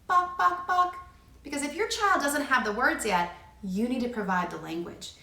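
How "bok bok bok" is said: "bok, bok, bok" clucked high and a little louder; chicken clucks. Shown as a demonstration. Parent clucking to a toddler, high playful chicken sound. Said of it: "you're gonna go".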